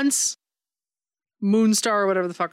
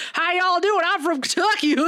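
high-pitched, hammed-up Southern twang; caricature Southern accent; 9 semitones higher than usual. Podcaster mocking a British actor's attempt, cartoonish Southern accent. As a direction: mimic a terribly overdone Southern accent. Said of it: "It is a caricature of a Southern accent".

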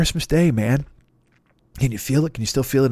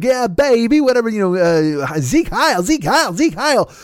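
high-pitched hep-cat dictator; Dick Shawn's hippie Hitler; 8 semitones higher, 3 dB louder, slower than usual. Acted. Actor pretending to be Hitler, hippie put-on. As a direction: chant it like a groovy beatnik Führer. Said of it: "being Hitler and pretending to be like".

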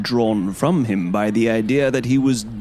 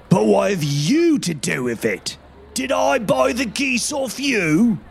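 a furious market trader, higher and far; angry salesman; 5 semitones higher than usual. A narrator's reading. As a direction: shout him away in anger. Angry salesman shouting at man.

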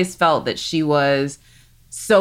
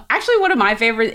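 usual speaking voice

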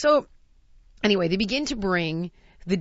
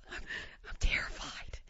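soft, terrified mock whisper; retold terror; 13 dB quieter than usual. Terrified diner confessing to dining partner, mock-terrified whisper. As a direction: whisper it as if frozen with fear. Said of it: "So now I'm in terror".

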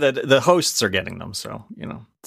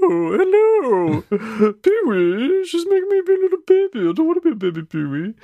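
Terry from Pee-wee's Playhouse; 13 semitones higher than usual. Retold or imitated. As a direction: whine it up high like a pestered kid. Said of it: "as Terry from Pee-wee's Playhouse".

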